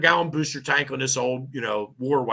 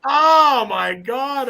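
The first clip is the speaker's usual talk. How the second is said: stunned oh my God; 9 semitones higher, 5 dB louder, much slower than usual. Real, not acted. Reliving the absurd scene.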